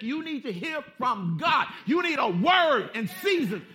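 loud, slow insistent call; preaching emphasis. Acted. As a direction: press it home slowly.

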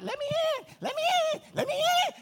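high pleading cry, let me in; begging at the door. Acted. Shut-out bride pleads at the locked door, high and begging. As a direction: beg to be let in, high-pitched.

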